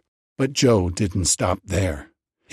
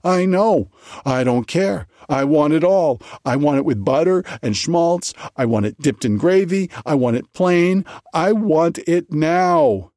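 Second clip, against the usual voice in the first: fever pitch; 7 semitones higher than usual. A narrator's reading. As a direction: insist like a starving child. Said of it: "his voice slowly rising to a fever pitch".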